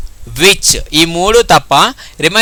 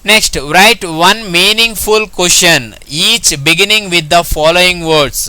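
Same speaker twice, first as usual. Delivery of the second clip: slow dictation; much slower than usual. Shown as a demonstration. Teacher reading exercise instruction slowly.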